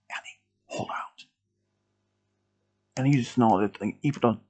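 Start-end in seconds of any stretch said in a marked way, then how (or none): 0.1-1.3 whispered encouragement; hushed urging. A narrator's reading. Milo to wounded Andy, whispering. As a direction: whisper it to someone badly hurt.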